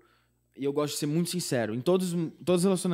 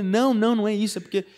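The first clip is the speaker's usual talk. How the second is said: flustered denial; 8 semitones higher, 6 dB louder than usual. Retold or imitated. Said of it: "Eu falei".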